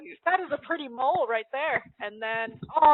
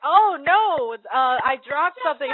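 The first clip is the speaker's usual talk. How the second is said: put-on high, loud flustered yelp of a girl caught out; hammed-up startled schoolgirl; mock surprise; 4 semitones higher, 9 dB louder, slower than usual. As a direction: squeak it out, caught red-handed. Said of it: "she's like".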